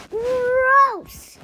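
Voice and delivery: loud, high cry of disgust; disgusted shout. Acted. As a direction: yell it in disgust. Disgusted by the kiss idea.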